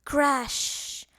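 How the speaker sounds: loud sound word; crashing lamp sound. A narrator's reading. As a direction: bang it out. Lamp knocked over.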